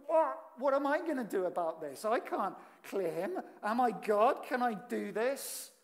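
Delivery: a startled king's outburst; panicked king. Retold or imitated. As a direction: throw up your hands in panic. King panics over the letter.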